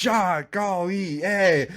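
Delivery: high, slow aw-shucks Midwestern nice guy; Kirk Cousins impression. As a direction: gush like a goofy clean-cut quarterback. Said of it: "He reminded me of Kirk Cousins, where he's like".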